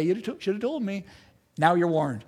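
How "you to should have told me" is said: mock complaint. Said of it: "Don't come to me ... and say".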